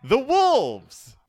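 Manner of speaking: high, loud alarmed cry; shouted. A narrator's reading. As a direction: shout it as frightened Sister in a story. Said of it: "shouted Sister".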